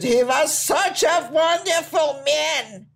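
high, slow, drawn-out wail; hammed-up grieving cry of a widow; mock wailing widow. Mourning widow at the funeral.

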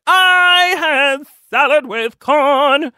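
high, drawn-out mock-proud call; proud announcement. Proudly reporting salad to diet friend, mock-proud.

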